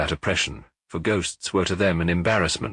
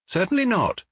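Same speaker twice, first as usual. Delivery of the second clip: high, affronted denial; indignant; 12 semitones higher than usual. A narrator's reading. Chairman denying that he knows the waiter, indignant. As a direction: deny it as if insulted, voice pitched up. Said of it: "answered Mister Audley indignantly".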